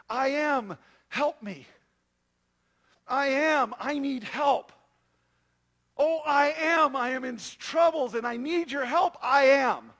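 loud, desperate cry for help, slowing on "Oh, I am". Shown as a demonstration. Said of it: "Do you hear what a different cry that is".

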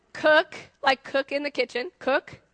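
Cook said short like cook in the kitchen, loud and clear. Shown as a demonstration. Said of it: "Let's practice".

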